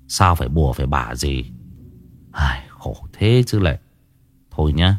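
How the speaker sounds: put-on low, stern older-brother voice; sighing resignation. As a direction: relent with a low, weary warning. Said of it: "Tôi thở dài".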